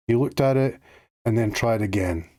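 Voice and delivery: ordinary delivery, nothing marked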